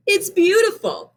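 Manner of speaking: high, admiring exclamation; delighted mom. A narrator's reading. Mom admires Benny's rose, high and delighted. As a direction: gush over the gift. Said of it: "said his mom".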